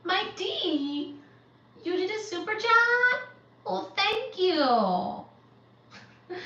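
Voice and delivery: high, loud, thrilled thanks; delighted surprise. Real, not acted. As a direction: gush over the surprise donation. Reacting to a super chat donation.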